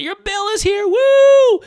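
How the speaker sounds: very high party squeal ending in a loud whoop; mock celebration. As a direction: cheer it like confetti is flying. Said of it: "party hats and, you know, confetti".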